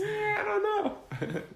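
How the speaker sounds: very high, soft and slow; cat voice. Pretend. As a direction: squeak it like the cat. Echoing the cat voice.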